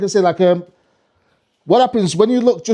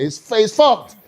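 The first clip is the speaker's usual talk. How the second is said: usual speaking voice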